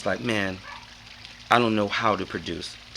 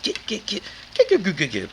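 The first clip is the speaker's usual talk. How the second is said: high rapid stuttered get; stutter scratch; 10 semitones higher than usual. Shown as a demonstration. Showing how he kept cutting the sample. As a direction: chop it like a scratch. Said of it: "And I kept doing it".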